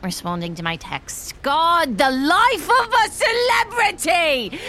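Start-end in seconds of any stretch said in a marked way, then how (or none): 1.4-4.6 loud, high, slow exasperated cry; outburst. Acted. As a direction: blow up in exasperation. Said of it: "I lost my cool there".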